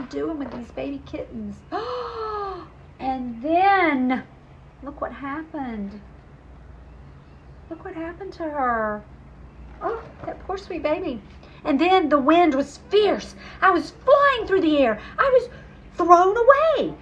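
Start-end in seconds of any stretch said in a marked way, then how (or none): 1.6-2.7 sharp high gasp; dramatic storyteller gasp. Acted. Reader gasps at kittens scene. 11.6-16.9 louder, higher, building excitement; rising dramatic telling. Acted. Dramatic fall through the air.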